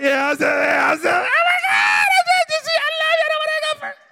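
very high, loud lovestruck squeal; giddy squeal. Retold or imitated. Lover squeals on the phone.

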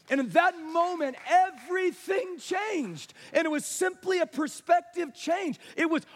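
stretched, ringing key words; forceful preaching. Real, not acted. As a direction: drive each phrase home to the congregation.

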